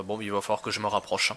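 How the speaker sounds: usual speaking voice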